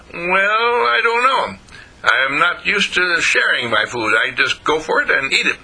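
high, doubtful skunk voice; reluctant skunk. A narrator's reading. Skunk hesitates over sharing food.

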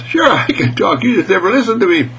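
high, quick little mouse voice; indignant mouse. Acted. Mouse retorts to the surprised cat, high and indignant. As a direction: pipe up as a small mouse.

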